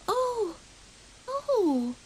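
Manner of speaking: very high wailing oh; dismayed cry. A narrator's reading. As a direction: wail in dismay. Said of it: "cried Grey Rabbit".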